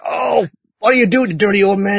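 high, outraged lady voice, lightly played; scandalised lady. Lady scolding Joseph after the skirt flips, in a high outraged voice. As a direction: shriek at the dirty old man in a high put-on lady voice.

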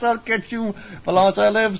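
high-pitched hammed-up villain threat; villain's threat. Acted villain's parting threat.

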